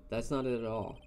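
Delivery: ordinary talk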